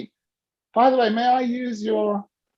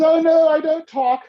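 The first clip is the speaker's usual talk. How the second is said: imitating Barbara; 9 semitones higher, 6 dB louder than usual. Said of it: "And she says".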